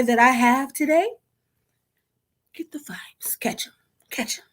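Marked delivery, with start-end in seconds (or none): 2.6-4.4 breathy playful whisper. Real, not acted. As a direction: toss it off in a whisper.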